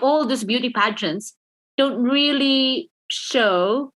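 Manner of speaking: ordinary delivery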